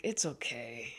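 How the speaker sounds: soft whispered brush-off; whisper, not wanting trouble; timid whisper; meek whisper. Retold or imitated.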